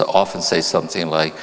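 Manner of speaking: ordinary delivery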